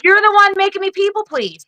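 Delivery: high-pitched, exasperated comeback; indignant retort. Retold or imitated. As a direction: throw the accusation back, pitch jumping up. Retorting to accusing family.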